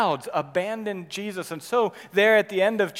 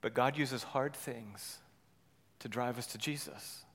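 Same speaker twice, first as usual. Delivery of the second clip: quiet emphasis; 6 semitones lower, 10 dB quieter than usual. Acted. Pastor drops soft and low for the point. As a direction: let the truth settle gently.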